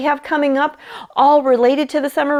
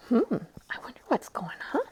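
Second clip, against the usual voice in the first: whispered aside; 14 dB quieter than usual. Real, not acted. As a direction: lean in and whisper the wonder. Aside to children.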